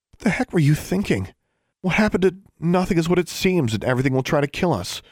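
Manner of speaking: high, exasperated dressing-down; incredulous, exasperated telling-off; scolding. Acted. Sister scolds her hurt brother.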